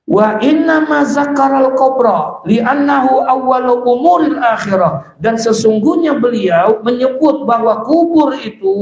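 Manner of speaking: high, ringing recitation of scripture; forceful preaching. Acted. Preacher reading an Arabic text to the congregation, high declamation.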